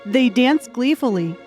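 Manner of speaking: usual speaking voice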